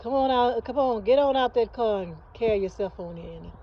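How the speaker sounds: put-on Mississippi accent. Aunt calling visiting family in from the car, hearty Mississippi accent. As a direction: call out a welcome in a Mississippi accent. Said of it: "Mississippi accent, she said".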